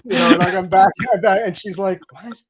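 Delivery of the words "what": small, high, hushed question; baffled mock reply. Wife reacting to his gibberish, quietly baffled. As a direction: imitate a bewildered listener, very soft and pitched up.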